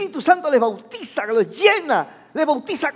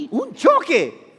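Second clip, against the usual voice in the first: gasp, then slow mock alarm; mock shocked gasp; 2 semitones higher, slower than usual. Preacher plays a curious neighbour hearing a crash, mock shock.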